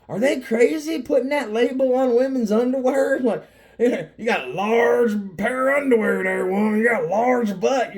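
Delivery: loud, high mock outrage; hammed-up incredulous bantering; giving her hell. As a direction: holler it teasingly in disbelief. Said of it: "I'm just giving her hell".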